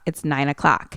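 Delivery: ordinary talk, nothing marked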